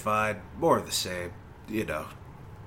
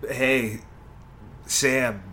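normal talking voice

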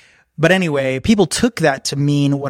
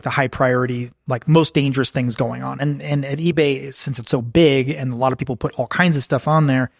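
usual speaking voice

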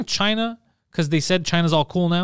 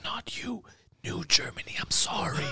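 hushed, unvoiced mock apology; whispered aside; 3 semitones higher, 12 dB quieter than usual. Premier leaning over to his lawyer, whispered aside. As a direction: lean in and whisper it confidentially. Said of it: "he leans over to his lawyer".

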